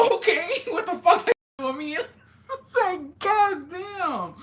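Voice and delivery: high laughing disbelief, words squeezed through laughter; cracking up in disbelief; laughing hard. Real, not acted. Laughing recalling the heart attack.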